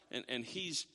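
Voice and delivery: ordinary talk, nothing marked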